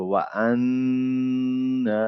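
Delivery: very slow আন্না with a long humming n; time on noon. Shown as a demonstration. Teacher showing timing on noon, আন্না long held. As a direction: hold the n of আন্না, then stretch the vowel. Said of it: "নুনে সময় দিতে হবে".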